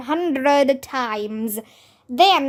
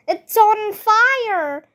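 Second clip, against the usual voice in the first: high, slow alarmed yell; shouted; 8 semitones higher, slower than usual. Acted. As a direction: cry out that it's burning. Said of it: "Ryan shouted".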